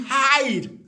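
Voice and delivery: ordinary delivery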